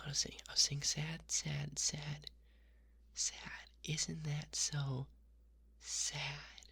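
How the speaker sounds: whispered sad, each one punched a little harder; emphasizing sad. Shown as a demonstration. Showing the listener how emphasis changes sad. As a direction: lean into the word sad every time. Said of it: "I'm saying the same word, but I'm emphasizing".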